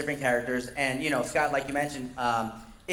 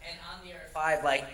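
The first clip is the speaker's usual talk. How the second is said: usual speaking voice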